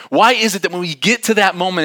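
ordinary talk, nothing marked

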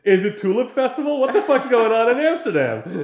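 high, loud mock disbelief; mock outrage. Real, not acted. Friend riffs on the booked-out city in high, loud mock disbelief. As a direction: ham up the outrage at a full city.

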